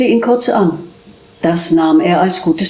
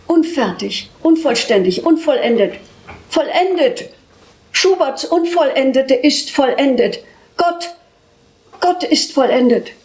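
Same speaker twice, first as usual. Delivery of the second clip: high, cracking despair; 5 semitones higher than usual. A narrator's reading. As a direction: let the voice break upward. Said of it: "Die Stimme überschlug sich, schrie sich heiser". (sich überschlagende Stimme)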